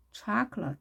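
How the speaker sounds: "초콜릿" with long stressed CHAH, weak rest; stress on first syllable. Shown as a demonstration. Teacher illustrating a stress rule, first syllable of "초콜릿" stressed. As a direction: stress the start of "초콜릿" and reduce the other vowel. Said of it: "강세가 있으면 나머지 엄마 소리들은 약해진다".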